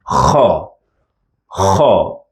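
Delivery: kha rasped from the back of the throat; like a snore, but from the throat; throat kha sound. Shown as a demonstration. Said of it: "comes from the throat".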